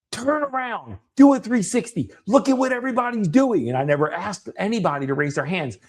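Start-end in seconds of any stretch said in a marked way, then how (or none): 0.1-3.7 high, forceful rallying commands; retelling a coach's call across a room; intense, assertive. Retold or imitated. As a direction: urge them on at a high pitch. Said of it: "I'm probably being more assertive".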